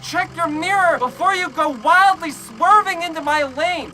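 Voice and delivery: high-pitched re-enacted scolding; indignant retort. Storyteller rejecting the other driver's excuse, indignant scolding. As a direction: throw the excuse back angrily.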